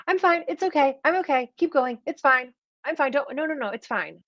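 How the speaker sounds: high, rushed, hammed-up denial; lightly mocked forced cheer; mock brushing off. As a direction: imitate someone insisting they're fine. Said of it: "some people are like".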